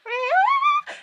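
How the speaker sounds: very high-pitched mock scream; imitated scream. Vlogger imitating Felicity's mom finding the ring, high mock scream. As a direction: let out a piercing squeal in imitation. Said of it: "because of her scream when she found that ring".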